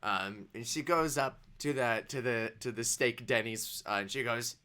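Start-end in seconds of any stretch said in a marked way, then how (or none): none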